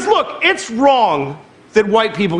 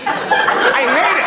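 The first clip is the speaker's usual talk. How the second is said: ordinary delivery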